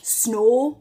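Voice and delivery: snow with a long pure "aw"; Geordie snow. Shown as a demonstration. Teacher giving Geordie examples, long-vowelled snow. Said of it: "'Cause in Geordie".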